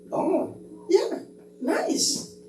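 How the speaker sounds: high, airy, offhand "oh yeah, nice"; unimpressed reply. Acted. Indifferent listener brushing off the pastor's story, offhand. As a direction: shrug it off lightly. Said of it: "Y la otra persona dice".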